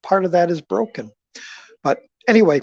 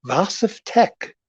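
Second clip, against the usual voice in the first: ordinary talk, nothing marked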